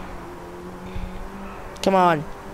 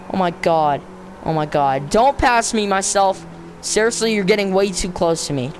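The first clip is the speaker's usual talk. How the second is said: ordinary delivery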